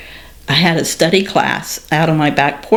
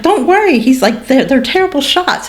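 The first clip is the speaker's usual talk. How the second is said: imitating husband, casually unbothered; 10 semitones higher than usual. Husband on the phone reassuring his wife, mock casual. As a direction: brush it off like it's nothing.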